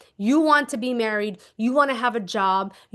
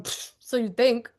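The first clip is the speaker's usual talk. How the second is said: mock smug pfft; wife smirking at husband's gift; smug scoff. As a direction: scoff knowingly at him. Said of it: "I'm just sitting here going".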